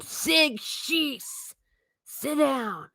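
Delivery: high-pitched command, held back in volume; mock yell. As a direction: order the class down in a high voice. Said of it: "what would be yelled the most".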